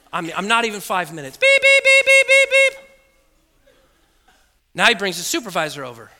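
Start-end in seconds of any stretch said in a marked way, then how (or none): 1.4-2.9 high, loud repeated beeps; imitated machine alarm. Donation machine going off mid-session, imitated beeping. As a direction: mimic a shrieking monitor alarm.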